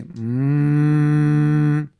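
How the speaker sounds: imitated cow moo. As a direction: hum it out like a grazing cow. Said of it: "When the cows say".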